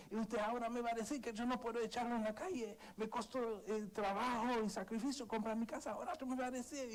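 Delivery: imitating father's shouted complaint, high and tense; mock yelling father. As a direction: rant like the wronged father. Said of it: "empezó a gritar".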